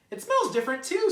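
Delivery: a tiny bird full of spring joy; chirpy little bird. A narrator's reading. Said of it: "said Bird".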